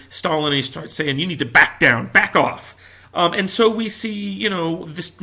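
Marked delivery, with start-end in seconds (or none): 1.2-2.8 loud, firm warning; retold yell. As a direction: push him back with your voice. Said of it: "he starts yelling at Stalin".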